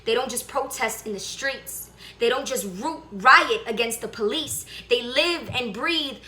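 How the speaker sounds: impassioned protest poem, building in pitch and force; spoken word poem. Acted. Performs own protest poem.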